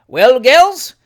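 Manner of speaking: loud, high, jovial uncle greeting; boisterous old gentleman; hearty Uncle Tom. A narrator's reading. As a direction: call out cheerily to the girls.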